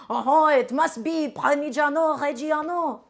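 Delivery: badly faked Italian accent, slow and drawn out; mock an Italian food purist in a clumsy accent; botched Italian accent with drawn-out vowels. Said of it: "imagine this was an Italian accent".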